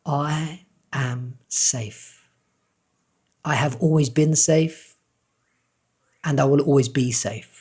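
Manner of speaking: slow, tender affirmation with long pauses; said with love. Shown as a demonstration. Coach modelling an affirmation for viewers to repeat, slow and tender. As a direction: let each word land gently and lovingly. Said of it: "With love, silently say this".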